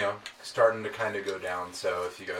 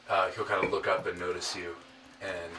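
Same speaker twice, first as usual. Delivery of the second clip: ordinary delivery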